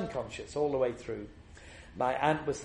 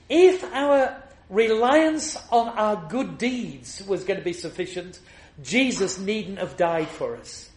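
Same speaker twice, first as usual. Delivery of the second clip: preaching at full force; 8 semitones higher, 9 dB louder than usual. Acted. Preacher to his congregation on the cross, thundering sermon. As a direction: proclaim it to the back of the church, hammering every refrain.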